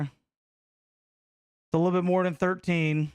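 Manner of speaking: slow, drawn-out sarcastic correction. Real, not acted. Streamer mocking chat's count of titles, slow sarcasm. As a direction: stretch it out to rub it in.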